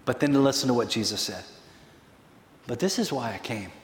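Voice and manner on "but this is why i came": soft, low and understated; hushed quote. Acted. Preacher quoting Jesus on his purpose, hushed. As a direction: say it quietly and low, letting it sink in.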